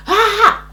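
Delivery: short high "ah"; sharp cry of acted pain; re-enacted scream. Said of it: "I was screaming".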